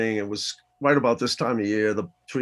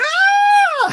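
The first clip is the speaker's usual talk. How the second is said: shouted laugh; 10 dB louder than usual. Retold or imitated.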